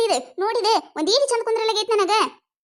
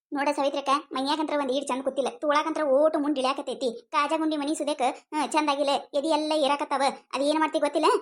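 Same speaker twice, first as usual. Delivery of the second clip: sulky complaint; 5 semitones lower, 5 dB quieter than usual. Acted. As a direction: lower and softer, a grudging list of faults. Customer lists the faults with the blouse.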